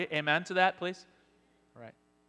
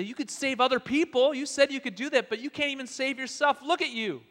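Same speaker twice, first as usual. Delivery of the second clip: sneering, higher and louder jeers; loud mocking taunt; 11 semitones higher, 11 dB louder than usual. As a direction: taunt him like a smug priest. Priests mocking Jesus on cross.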